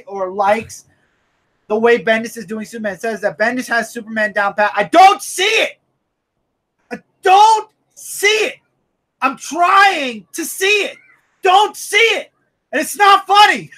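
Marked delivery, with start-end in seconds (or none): 4.8-13.7 exasperated shout. Real, not acted. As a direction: belt it in full frustration. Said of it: "making my jaws hurt from all that".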